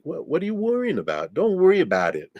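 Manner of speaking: lightly voiced Jersey accent, half-joking. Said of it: "I can't do a Jersey accent".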